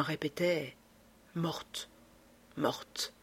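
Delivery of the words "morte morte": much softer, musing, as if not grasping it; pensive. Acted. Lupin repeats the word over her body, soft and pensive. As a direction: say it quietly to yourself, uncomprehending. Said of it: "d'un ton réfléchi".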